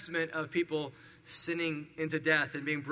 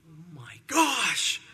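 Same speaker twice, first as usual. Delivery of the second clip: slow, drawn-out comic disbelief, swelling louder; mock shock; 4 dB louder, much slower than usual. Burial crew reacting as they come in, mock shock. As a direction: play the stunned burial crew, exaggerated.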